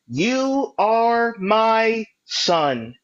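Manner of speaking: usual speaking voice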